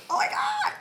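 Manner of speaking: very high squealed oh my God; shocked squeal. Retold or imitated. Quoting own shocked reaction.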